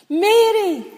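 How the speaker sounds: high, loud delighted shout of a name; ringing joyful call. Acted. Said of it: "Elizabeth's voice rang".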